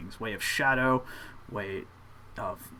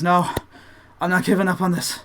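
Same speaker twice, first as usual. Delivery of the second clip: acted protest, louder and higher; defiant refusal; 4 semitones higher, 5 dB louder than usual. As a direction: play the determined apprentice refusing to quit. Apprentice refuses to give up.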